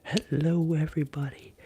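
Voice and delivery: high, loud hello; bright greeting call. Real, not acted. Streamer greets everybody joining the live stream, high calling hello.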